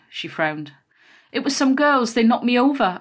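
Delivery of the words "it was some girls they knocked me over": upset, higher and louder, mildly tearful; sobbed. A narrator's reading. Child desperate for a hug, mildly tearful. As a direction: plead it out, pitch raised, understated sob. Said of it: "I sobbed".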